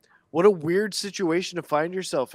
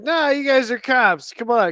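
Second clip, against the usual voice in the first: retold call-out; 7 semitones higher, 5 dB louder than usual. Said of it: "I'm like".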